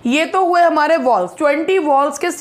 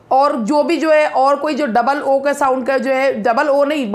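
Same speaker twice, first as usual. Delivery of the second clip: normal talking voice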